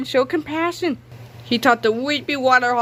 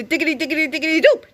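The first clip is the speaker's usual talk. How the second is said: quick bouncy, higher rattle; fast sing-song; 5 semitones higher, faster than usual. Acted. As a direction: rattle it off like a move. Naming trick with flair.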